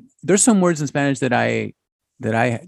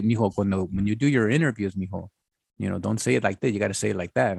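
softer, gentle motherly advice; a quieter hint of mom coaching; imitating mom; 6 semitones lower, 8 dB quieter than usual. Son recalls mom's advice before interviews, soft motherly voice. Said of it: "I remember my mom saying".

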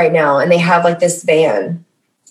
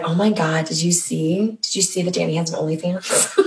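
much softer, scandalized gossip; hushed gossip; 8 dB quieter than usual. Retold or imitated. People gossiping that Danny has an OnlyFans, hushed. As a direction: lean in and gossip softly.

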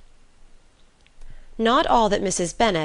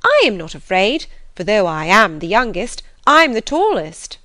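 loud, bold declaration; self-assured girl standing her ground; stout; 3 semitones higher, 7 dB louder than usual. A narrator's reading. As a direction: boast stoutly, lifting high at the end. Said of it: "said Lydia stoutly".

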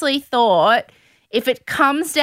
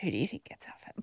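whispering; 8 semitones lower, 18 dB quieter, much faster than usual. Acted. Dramatic whispered question at rose ceremony.

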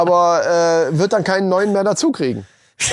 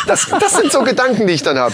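usual speaking voice

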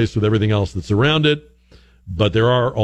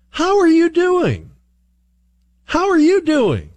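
high, slow, overly earnest how are you doing; earnest greeting; slower than usual. Retold or imitated. Host mimicking friendly strangers, in high earnest greeting. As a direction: lay on the Southern sincerity. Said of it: "asked with great earnest and sincerity".

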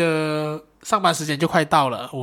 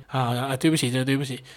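normal talking voice

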